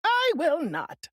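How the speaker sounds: high, slow witch voice; witch retorting. A narrator's reading. Wicked witch refusing the little girl, slow high retort. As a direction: spit it back slowly like an old witch. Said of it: "retorted the witch".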